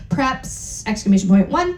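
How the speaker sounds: ordinary talk, nothing marked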